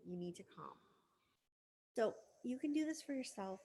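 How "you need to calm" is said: calming adult voice. Pretend. Inner adult soothing angry self, calm and soft. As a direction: settle yourself down gently. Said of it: "the adult will swoop in and go".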